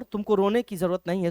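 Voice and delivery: ordinary talk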